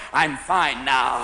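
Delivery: low, slow show of calm; overdone recovered-patient composure; reformed drinker. Acted. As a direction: insist slowly, deep and drawn out. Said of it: "at Betty Ford Hospital going".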